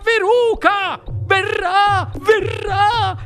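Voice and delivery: very high, slow hysterical wail; shrieking. A narrator's reading. Mrs Salt imagines her daughter roasted, shrieking. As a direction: wail it out, dragging every word. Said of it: "strepitò la signora Salt".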